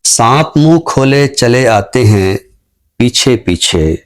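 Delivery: slow, measured poetry recital with lingering line ends; ghazal recitation. Acted. Poet reciting his ghazal to the show's audience, slow and measured. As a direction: recite each line slowly, savouring it.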